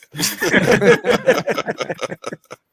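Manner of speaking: loud rolling laughter; long laugh. Real, not acted. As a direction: keep laughing hard. Keeps laughing at the clip.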